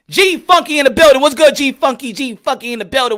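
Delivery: loud, excited welcome; hype shout-out. Real, not acted. Streamer hyping a fellow boxer's arrival, loud hype. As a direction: announce him like he just walked in.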